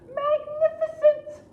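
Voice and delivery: very high, loud, slow delight; high loud princess. Acted. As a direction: gush with fake awe.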